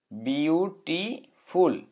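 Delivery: Beautiful slowly split into বিউ, টি, ফুল; syllable by syllable. Shown as a demonstration. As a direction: break beautiful into separate, drawn-out syllables with pauses. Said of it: "দুইবারে উচ্চারণ করতে হয়". (Beautiful ধীরে ধীরে ভেঙে ভেঙে উচ্চারণ)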